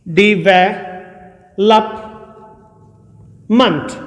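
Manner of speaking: Development drawn out syllable by syllable; slow teacher's demo of development. Shown as a demonstration. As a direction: stretch development slowly for learners. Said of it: "देखिए कैसे".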